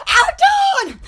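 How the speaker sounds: high, bright call of a name; excited greeting. Real, not acted. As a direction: call out happily to a friend. Greeting a viewer warmly.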